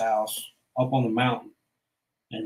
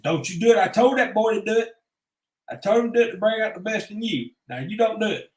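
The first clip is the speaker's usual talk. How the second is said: higher, firm coach voice; coach stepping in; 8 semitones higher than usual. Retold or imitated. Coach breaking up a fight, stern higher warning. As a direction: lay down the law like a coach.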